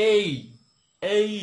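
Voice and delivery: "এই" mostly long e with a brief i tail; correct letter A. Shown as a demonstration. Teacher shows the eighty-twenty split, "এই" long e, tiny i. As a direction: stretch the e in "এই", keep the i short. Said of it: "এ এর উচ্চারণটা যদি eighty percent".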